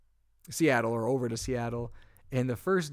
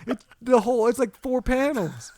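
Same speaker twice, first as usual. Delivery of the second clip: incredulous, raised voice about the wrecked rental car; outraged retelling; 8 semitones higher, 8 dB louder than usual. Real, not acted. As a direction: let the damage shock you all over again.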